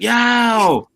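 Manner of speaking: high excited yell; crowd yo. Retold or imitated. Rapper imitating crowd at show, excited yell. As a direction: shout it like a hyped crowd.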